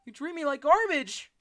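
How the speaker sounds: high, loud shouted complaint; shouting. Pretend. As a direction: yell it high at her. Arguing with Mom.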